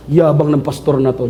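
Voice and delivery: a low grumbling dad, lightly voiced; annoyed old father. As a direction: mutter like an offended father. Father reacting to rude pastor.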